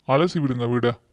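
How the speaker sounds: usual speaking voice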